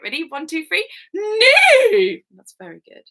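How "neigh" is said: high, loud whinny; whinny loudly for children to copy; rude horse neigh. Shown as a demonstration. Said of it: "Can you help me do it?".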